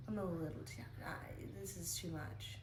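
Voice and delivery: tired unvoiced whisper. Real, not acted. Whispering to viewers about a rough morning. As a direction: breathe it out, too tired to voice it.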